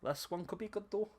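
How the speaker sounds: wobbly mock Scottish accent; bad Scottish. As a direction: attempt Scottish and lose it halfway. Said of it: "fell out my Scottish there for a second".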